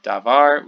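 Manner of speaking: davar, full vowel a in the first syllable, stress on VAR; Hebrew davar. Shown as a demonstration. Teacher contrasting singular with plural, clear careful pronunciation. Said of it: "The kamets changes to the shava".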